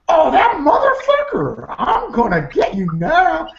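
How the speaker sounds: mock vengeful glee. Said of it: "I usually at least go".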